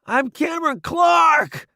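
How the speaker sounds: high slow yell over the bombs; shouting. Acted. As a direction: yell names across the noise. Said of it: "I shout over the loud whistles".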